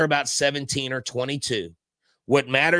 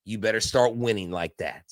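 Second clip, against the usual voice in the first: ordinary talk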